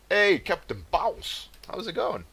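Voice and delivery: a silly squeaky welcome to a viewer; mock high greeting.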